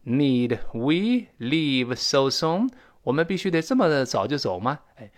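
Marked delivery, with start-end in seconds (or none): 0.0-2.7 very slow, word-by-word question; drawn-out example with questioning rise; slow model reading. Shown as a demonstration. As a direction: spell out each word slowly for learners. Teacher repeats example slowly.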